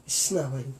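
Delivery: mock gloomy. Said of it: "staring out the window going".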